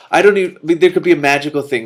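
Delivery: loud, high, eager aside; excited aside. Real, not acted. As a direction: burst out with delight.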